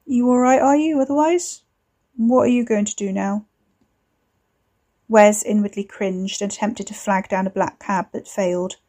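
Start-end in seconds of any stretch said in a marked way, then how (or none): 0.0-3.5 forced small talk. Acted. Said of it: "working his way up to small talk".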